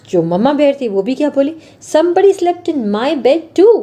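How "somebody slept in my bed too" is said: higher, slow mama bear voice; a mother bear joining the complaint. Acted. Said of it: "said Mama Bear". (ऊँची धीमी मम्मा भालू की आवाज़)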